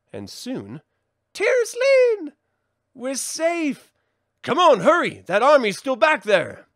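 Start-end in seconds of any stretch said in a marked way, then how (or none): 1.3-3.9 joyful relief. Acted. Arriving at the castle.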